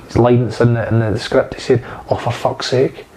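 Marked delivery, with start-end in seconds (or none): 2.1-3.0 quiet, resigned oh for fuck's sake; low-key annoyed grumble; muttered curse. Retold or imitated. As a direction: mutter it like you spilt juice. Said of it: "it wasn't a screaming".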